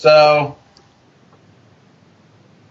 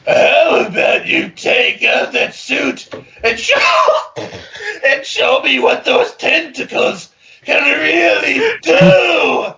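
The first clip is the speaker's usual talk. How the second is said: high, slow, giggling seductive line; laughing through it; 12 semitones higher, 4 dB louder, slower than usual. Acted. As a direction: try to stay sultry while cracking up. Reading seductive fanfic dialogue.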